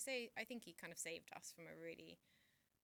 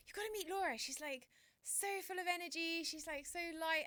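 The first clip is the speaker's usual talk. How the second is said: higher, louder gushing praise; gushing excited quote; 11 semitones higher, 8 dB louder than usual. Retold or imitated. Guest repeats a stranger's praise, gushing and excited. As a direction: rave about Laura like a delighted stranger. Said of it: "someone came and said".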